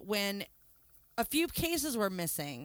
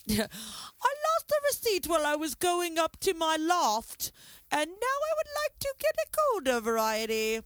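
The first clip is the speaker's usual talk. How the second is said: mock British accent; 7 semitones higher than usual. Mocking the beer thief in an English accent. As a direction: put on a posh English thief voice, way up high. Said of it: "accent I'm doing".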